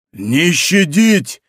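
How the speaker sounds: very slow, drawn-out cry; shouted order. A narrator's reading. Enemy horseman answering Winter, merciless shouted order. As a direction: stretch the command out as a shout. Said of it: "вскричал по-французски в ответ Винтеру голос".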